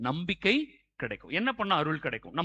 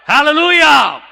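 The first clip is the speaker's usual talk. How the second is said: very loud, high Hallelujah; rousing praise shout; 9 semitones higher, 18 dB louder, much slower than usual. Real, not acted. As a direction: shout to rouse a sluggish crowd. Demanding louder response, hands raised. (உச்சக் குரலில் துதி முழக்கம்)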